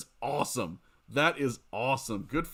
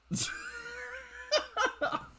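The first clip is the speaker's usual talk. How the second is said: a much higher shrieking burst of laughter; real squeal of laughter. Real, not acted. Laughs at animal calls question.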